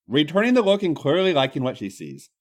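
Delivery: plain reading voice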